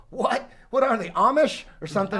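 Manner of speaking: loud, high-pitched disbelieving question; incredulous. Retold or imitated. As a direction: replay your baffled reaction, pitch jumping up. Retells his disbelieving reaction.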